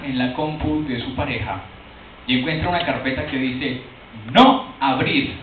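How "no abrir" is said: slow, louder, spelled out as a warning; reading the forbidden folder name, slow and loud; ominous label. Pretend. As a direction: read it like a warning sign.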